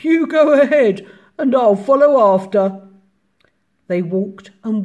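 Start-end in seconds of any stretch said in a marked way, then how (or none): 0.0-2.8 loud, higher monster voice; amused Gruffalo. A narrator's reading. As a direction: boom it out with mock amusement. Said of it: "bursting with laughter".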